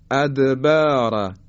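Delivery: ادبار slowly, the د echoing with qalqala; drawn-out ادبار, bounce on د; slow recitation demo. Shown as a demonstration. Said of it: "حرف دال".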